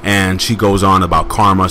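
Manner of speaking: ordinary talk, nothing marked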